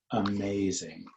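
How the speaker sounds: usual speaking voice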